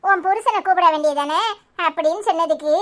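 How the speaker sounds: ordinary delivery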